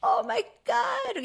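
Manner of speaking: high, slow, amazed gasp of words. Retold or imitated. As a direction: marvel at the toddler's scat, drawn out. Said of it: "we were just like".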